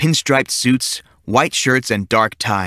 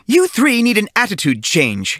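stern teacher; 10 semitones higher than usual. A narrator's reading. Teacher lectures three kids.

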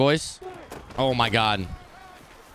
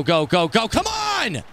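higher, shouted urging; excited cheering; 5 semitones higher, slower than usual. Real, not acted. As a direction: cheer the runner on. Cheering a run toward the end zone.

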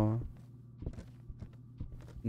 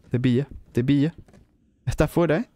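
usual speaking voice

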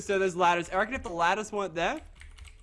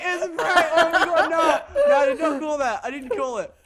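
high, loud whooping with laughter; ecstatic screaming; 9 semitones higher, 7 dB louder than usual. Real, not acted. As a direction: scream in giddy disbelief. Reaches the win in game.

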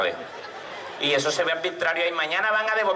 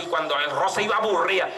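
normal talking voice

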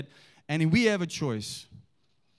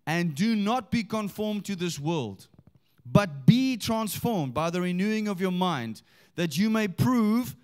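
slow, emphatic Bible reading; scripture reading; 4 semitones higher than usual. Acted. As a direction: proclaim the verse to the congregation, drawing words out. Reads scripture aloud.

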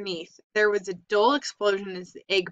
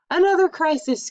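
higher, louder fed-up groan; exasperated groan; 8 semitones higher, 7 dB louder than usual. A narrator's reading. Fern groaning at the frog's mess, high and loud. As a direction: throw it up high like a weary sigh. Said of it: "groaned Fern".

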